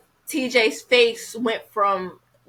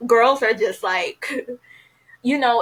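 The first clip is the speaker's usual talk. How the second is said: ordinary delivery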